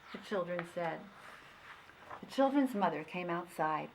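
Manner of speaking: plain reading voice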